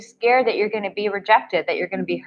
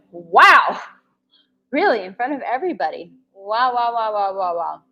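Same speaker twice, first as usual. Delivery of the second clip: stunned disbelief. Real, not acted. Coach reacting to a crude live comment, stunned disbelief. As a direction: act floored by what he just read.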